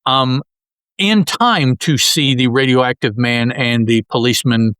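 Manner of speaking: ordinary talk, nothing marked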